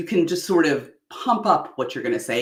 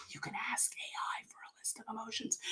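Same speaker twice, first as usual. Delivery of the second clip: soft whispered aside; hushed aside to listeners; 7 semitones higher, 20 dB quieter than usual. Real, not acted. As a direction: drop to a whisper for the tip.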